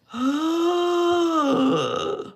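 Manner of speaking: long, high, dramatic gasp; hammed-up gasp of outrage; shocked gasp. Mother's shock at son's backtalk.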